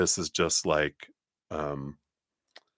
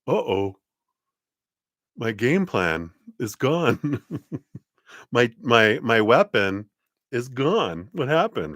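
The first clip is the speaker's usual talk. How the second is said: mock dismay; 6 semitones higher than usual. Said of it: "Now there's like".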